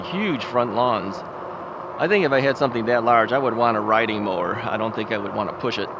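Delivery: ordinary talk, nothing marked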